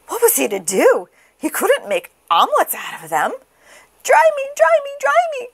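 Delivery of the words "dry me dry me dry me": very high, pleading chick; crying baby chick. A narrator's reading. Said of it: "cried the soggy baby chick".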